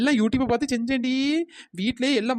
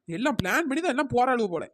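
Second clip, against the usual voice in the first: ordinary talk, nothing marked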